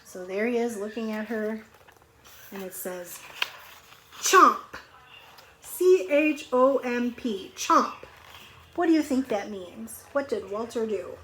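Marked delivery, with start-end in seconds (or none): 4.2-4.6 loud chomp. Acted. Teacher reading the goldfish's bite to kids, loud chomp. 5.6-7.5 spelled out slowly. Shown as a demonstration. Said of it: "spelling the word out". 7.5-8.0 loud chomp. Shown as a demonstration. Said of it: "spelling then reading it".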